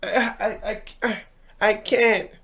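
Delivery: put-on high stammering child voice; a little boy stuck for words; kid voice.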